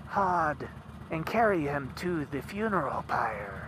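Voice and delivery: an eerie foretelling that sinks to a hush; prophesying seeress. Retold or imitated. The seeress foretelling Vali's vengeance, eerily high and hushed.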